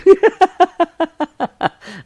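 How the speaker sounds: high, loud burst of laughter. Real, not acted. Teacher laughing at a student's photo, a loud high burst.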